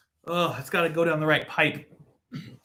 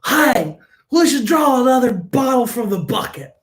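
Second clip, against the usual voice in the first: put-on higher, louder old-time broadcaster; loud announcer impression; 9 semitones higher, 7 dB louder than usual. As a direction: boom it out like a ballgame announcer. Continues announcer voice.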